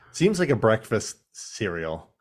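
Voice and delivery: usual speaking voice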